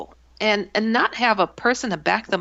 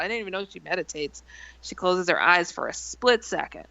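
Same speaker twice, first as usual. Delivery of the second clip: ordinary talk, nothing marked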